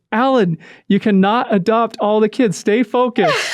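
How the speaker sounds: comic drawn-out telling-off; mock scolding wife. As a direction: lay down the law word by word, playfully. Acts out wife's scolding reply.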